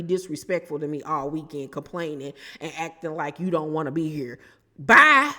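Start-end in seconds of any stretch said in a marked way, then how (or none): 4.8-5.3 loud dismissive bye. Acted. Dismissing the cast member.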